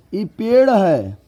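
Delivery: high loud slow claim on खंभा; mock quiz claim. Shown as a demonstration. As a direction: announce it grandly, then deny it. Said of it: "नहीं भाई". (खंभा पर ज़ोर, ऊँचा और धीमा)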